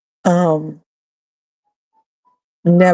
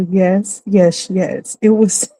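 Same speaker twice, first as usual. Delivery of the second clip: normal talking voice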